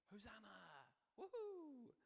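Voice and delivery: high, whooping mock cheer; crowd cheering. A crowd welcoming Jesus five days ago, mock cheering. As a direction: imitate a jubilant crowd, rising to a loud whoop. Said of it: "there was a crowd shouting".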